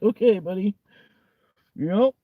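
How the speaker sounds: high, rising, unconvinced acknowledgement; mock humouring reply. Listener hearing a stale joke again, high mock politeness. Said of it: "you're just like".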